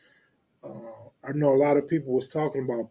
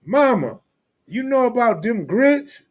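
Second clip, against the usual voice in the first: higher mock Southern voice; high imitation of a Southern friend; imitating Cartoon; 8 semitones higher, 6 dB louder than usual. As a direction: play the country storyteller asking mama. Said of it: "He like".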